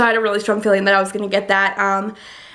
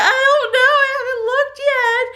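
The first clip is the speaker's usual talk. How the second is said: crying. Retold or imitated. Daughter answering mom about her role, high crying wail. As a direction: re-enact a flustered, squealing wail. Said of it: "I immediately start crying".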